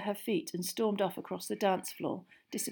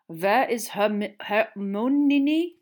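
put-on slow, loud, halting accented question; foreign student; 4 semitones higher, 8 dB louder, much slower than usual. A narrator's reading. Foreign student looking for his date, halting. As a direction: stumble through the name, rising.

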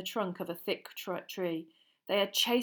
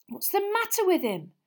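alarmed; 11 semitones higher, 4 dB louder than usual. Acted. Alarmed question under cloak.

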